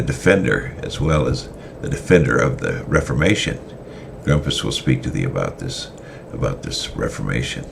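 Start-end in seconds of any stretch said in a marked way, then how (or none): none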